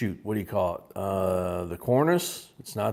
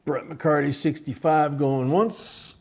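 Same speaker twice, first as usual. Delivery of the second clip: auctioneer call; 5 semitones higher, 4 dB louder than usual. Acted. Calling the auction bid.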